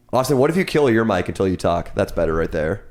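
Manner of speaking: normal talking voice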